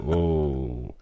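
low, sinking playful groan; hammed-up dismay; mock disappointment. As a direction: let the cheer collapse into letdown. Mock dismay as internet drops.

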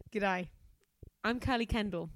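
hammed-up Australian greeting; horrendous Australian. Carly introducing herself, in a horrendous Australian accent. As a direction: put on a bad Aussie accent. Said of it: "Horrendous Australian".

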